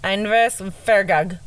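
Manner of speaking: Inverfargag said slowly in a trying Scottish accent, ending in gag; attempted Scottish accent. Shown as a demonstration. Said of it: "That was good".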